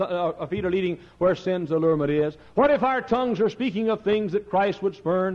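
lifted, ringing preacher's recital of verse; solemn pulpit poem; poem recitation. Acted. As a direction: declaim the poem to the congregation.

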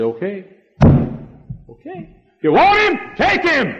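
high and loud, fed up; husband's jealous outburst, high and loud; bitter giving up. Retold or imitated. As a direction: throw it at her: take him then. Husband gives up in anger.

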